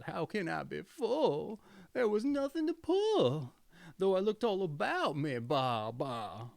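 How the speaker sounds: high, bleating goat voice, sly and sulky; a cunning goat whining that she's starving. A narrator's reading. The goat's bleating complaint.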